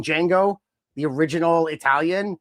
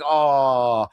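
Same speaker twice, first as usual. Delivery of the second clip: loud drawn-out group aww; a fan's exaggerated aww; mock disappointment; 5 dB louder than usual. Fan voicing everyone losing Chelsea's act, mock disappointment. Acting out fans' shared disappointment.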